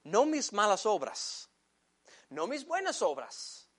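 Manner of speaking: ordinary talk, nothing marked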